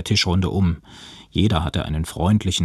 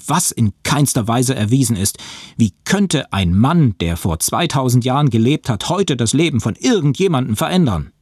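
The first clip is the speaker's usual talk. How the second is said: higher, louder, emphatic arguing; heated objection; 6 semitones higher, 5 dB louder than usual. A narrator's reading. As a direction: push back hard, exasperated. Heated argument, he dismisses the claim. (aufgebracht widersprechend)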